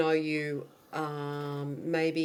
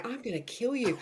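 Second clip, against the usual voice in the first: mock hushed threat; 4 semitones higher, 6 dB quieter than usual. Mock threat mouthed through studio glass.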